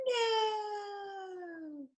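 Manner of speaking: high, long-drawn mock wail; imitated wail. Lecturer imitating the storyteller's dramatic cry, mock wail. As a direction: wail "no" high and let it slowly fade. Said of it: "something about it with the".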